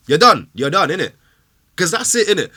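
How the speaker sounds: loud, high shout; shouted verdict. Real, not acted. Fan shouting that Xhaka is finished, loud shout. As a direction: yell it at him.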